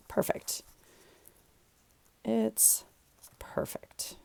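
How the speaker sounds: hushed, satisfied whisper. Real, not acted. Crafter whispering her satisfaction with the page layout. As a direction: whisper it as if you don't want to jinx it.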